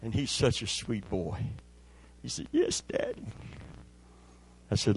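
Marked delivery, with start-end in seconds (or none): 2.5-3.3 put-on sick boy's voice. Sick son answers his father.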